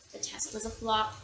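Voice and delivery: usual speaking voice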